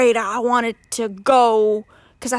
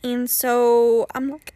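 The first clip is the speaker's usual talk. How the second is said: ordinary talk, nothing marked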